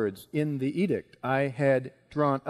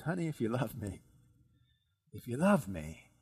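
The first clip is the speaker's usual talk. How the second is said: tender appeal; 4 semitones lower, 7 dB quieter than usual. Acted. Esther's plea put as wife's appeal.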